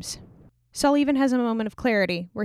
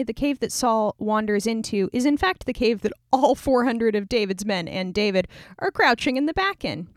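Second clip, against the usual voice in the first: usual speaking voice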